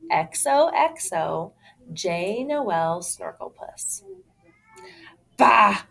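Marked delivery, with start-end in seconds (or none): 5.4-5.8 louder scornful bark; sneering. Acted. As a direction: spit it out in contempt. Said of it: "Alfie sneered".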